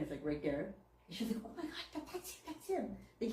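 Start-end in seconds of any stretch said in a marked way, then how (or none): 1.4-3.0 breathless whispered gasp; hushed excitement. Retold or imitated. Friend spotting her lost bird, whispered amazement. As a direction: whisper it in disbelief.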